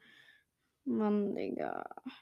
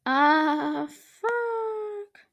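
frustrated cry; 9 semitones higher than usual. Real, not acted. Failure in game.

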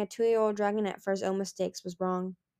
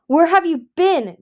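loud, high, slow furious roar; 9 semitones higher, 12 dB louder, slower than usual. Acted. Gil seizing his son Turtle, roaring at him. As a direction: bellow it at him in rage. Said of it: "Gil roared".

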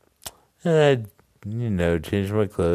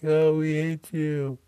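imitated campers; 10 semitones higher than usual. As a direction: whine it up high like pranked kids. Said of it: "they'd be like".

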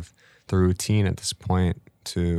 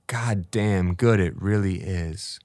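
slow savoring; slower than usual. Real, not acted. Savoring life's good moments.

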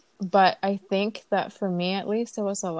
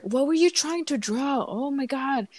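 higher-pitched exasperated retold jab; imitating his irritated disbelief; frustrated husband; 6 semitones higher than usual. Husband snapping at his wife over her drawing, mock exasperated. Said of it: "kept getting so frustrated with his".

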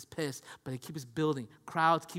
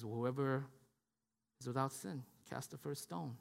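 softer solemn verdict; 6 semitones lower, 12 dB quieter than usual. Retold or imitated. Jesus answering the accusers.

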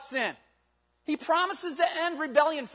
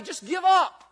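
Satan's taunting shout; 3 semitones higher, 7 dB louder, slower than usual. Retold or imitated. Satan voiced tempting the listener.

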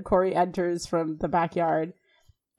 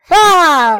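loud, high frustrated yell of Ah; an outraged squawk; exasperated shriek; 8 semitones higher, 11 dB louder than usual. Real, not acted. Reacting to Alan's line, loud frustrated cry.

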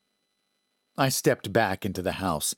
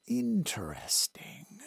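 slow, soft, thoughtful woman; drawn-out quiet musing; pensive woman's voice; 6 semitones higher, 8 dB quieter, slower than usual. A narrator's reading.

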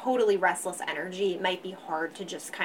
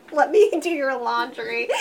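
laughing through a fussy offer; high, giggly helpful voice; laughing mock fussy; 5 semitones higher, 7 dB louder than usual. Imitating fussy Virgo partner.

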